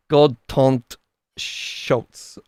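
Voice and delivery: name attempted with a French accent; slow, tentative French-accented pronunciation. Real, not acted. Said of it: "in a kind of a French accent".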